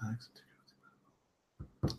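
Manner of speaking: whispered slowly under her breath; whispered to herself. Real, not acted. Thinking aloud during a reading, slow whisper. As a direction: murmur it while searching for the words. Pausing to think mid-sentence.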